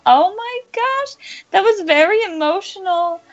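tearful gush of feeling; choked up. Real, not acted. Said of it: "[name]'s about to cry again".

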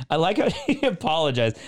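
laughing through the words; amused chuckle while speaking. Real, not acted. Laughing while reading review.

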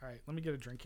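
normal talking voice

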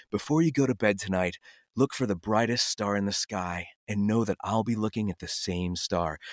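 low, soft, dreamy storybook prince; Disney prince voice. Retold or imitated. Uncle performing a prince line for five-year-old nieces, low and soft. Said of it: "in this very, you know, like, prince-like voice".

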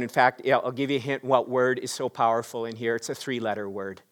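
ordinary talk, nothing marked

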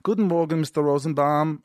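put-on formal greeting in a German accent; German driver. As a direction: imitate a German greeting his passenger. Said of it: "this guy in Germany".

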